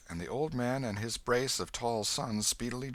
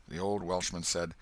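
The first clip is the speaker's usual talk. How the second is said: ordinary delivery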